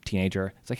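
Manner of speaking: ordinary delivery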